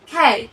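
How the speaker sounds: loud খেল, K with a puff of air; aspirated K. Shown as a demonstration. Teacher answering what happened to K, aspirated খেল. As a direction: release the K of খেল with strong breath. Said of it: "কে এর ক্ষেত্রে কি হলো?".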